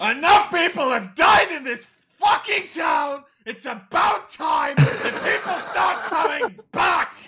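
high, loud, drawn-out tirade; furious shout. Acted. Character yelling a dead man back to life, furious shout. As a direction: roar each word out slowly, at full pitch.

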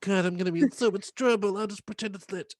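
lightly voiced nervous actress trailing off; panicky novice actress. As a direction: play the scared beginner muttering her excuse. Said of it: "a novice actress who went".

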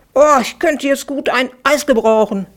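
yelled out. A narrator's reading. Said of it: "brüllte Klacks".

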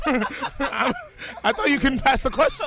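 high-pitched, laughing protest; flustered protest. Real, not acted. Guest to hosts, thought he could pass, flustered high protest.

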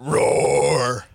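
dinosaur roar. Pretend. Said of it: "Did you just say roar?".

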